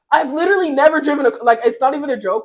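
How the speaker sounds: rushed loud insistence; fast emphatic. Real, not acted. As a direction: blurt it out fast. Insisting she can't drive.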